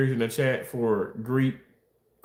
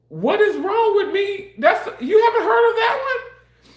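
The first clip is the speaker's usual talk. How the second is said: high, loud, laughing disbelief; incredulous laughter; 5 dB louder than usual. Real, not acted.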